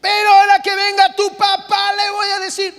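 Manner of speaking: high, loud mock threat about telling dad; yelling mom. Mother threatening her child to tell his father, loud and high. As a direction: shout the empty threat up high. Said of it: "la mamá que grita".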